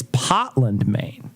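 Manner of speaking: slow "Potland" in a Maine accent, no R. Shown as a demonstration. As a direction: drop the R in "Potland", Maine style. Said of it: "I did learn how they say".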